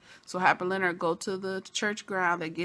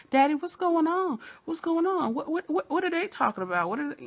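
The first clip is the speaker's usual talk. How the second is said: much higher, confused pleading; panicked son; 9 semitones higher than usual. Retold or imitated. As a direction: play the son caught out and flustered. Voicing panicked son in recap.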